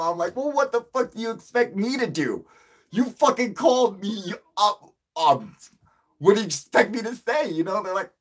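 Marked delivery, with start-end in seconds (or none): 0.4-7.5 high, loud exasperated comeback; indignant retort. Retold or imitated. Caller argues back at interviewers who called him, indignant.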